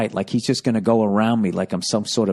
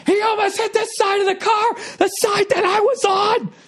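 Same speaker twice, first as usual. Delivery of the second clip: very high shrieking imitation of furious wife; screaming. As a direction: scream it in a piercing high voice, slowing at the end. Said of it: "she just screamed like".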